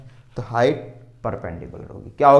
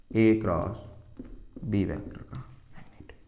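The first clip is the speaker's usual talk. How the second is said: whispered answer; soft whispered reminder; 4 semitones lower, 7 dB quieter than usual. Shown as a demonstration. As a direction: hush it out.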